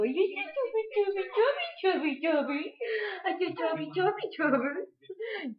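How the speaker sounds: put-on high sing-song cooing at the baby; baby talk. Shown as a demonstration. Mom cooing to her grumpy baby to make him laugh, high baby talk. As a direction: coo it up high like tickling a chubby baby. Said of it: "you go".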